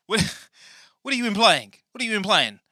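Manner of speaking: high, loud offended challenge; mock indignation. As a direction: demand an explanation, comically affronted. Indignant challenge in comic squabble.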